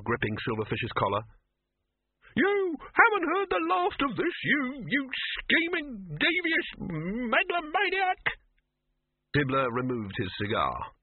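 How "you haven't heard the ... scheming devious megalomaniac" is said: very high, outraged squawk; outraged sputter. A narrator's reading. Silverfish curses Dibbler while gripped by the troll, outraged. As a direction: sputter insults high, slowing on each one.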